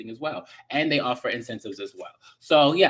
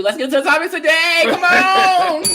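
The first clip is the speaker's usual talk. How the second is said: high, very loud rallying holler; hammed-up excited yell, pitched way up; hyped-up shout; 12 dB louder than usual. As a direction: shout the topic intro like a hype call. Hyped shouted launch of the topic.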